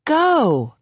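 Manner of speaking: bright high-pitched urging with a smile; encouraging. A narrator's reading. Friend nudges the hesitant narrator toward Jay, encouraging. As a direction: cheer them on with one high, falling word. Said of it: "she smiles encouragingly".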